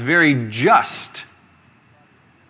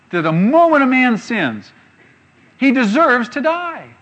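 preaching emphasis; 10 semitones higher, 6 dB louder than usual. Real, not acted. Preacher proclaims that sinners deserve to die, ringing loud. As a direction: drive the point home full force.

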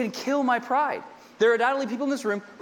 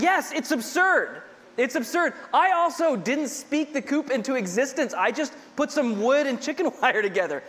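ordinary talk, nothing marked

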